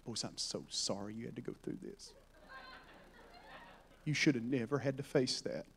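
very soft, low, pretend consoling; mock sympathy. Jesus imagined consoling the disciples after the storm, falsely tender. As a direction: coo it quietly, as fake comfort.

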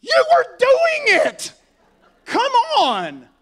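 high, loud, drawn-out outburst; exasperated rebuke. Acted. Jesus to Peter pulled from the water, exasperated. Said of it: "He rebukes him".

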